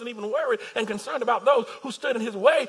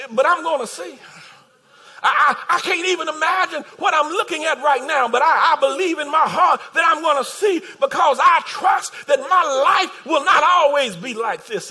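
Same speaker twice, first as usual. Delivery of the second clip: normal talking voice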